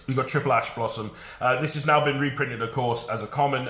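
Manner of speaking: usual speaking voice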